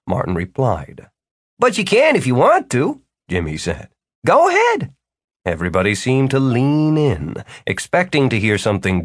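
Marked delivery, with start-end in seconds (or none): 1.6-4.9 encouraging host voice. A narrator's reading. Jimmy encourages, very high.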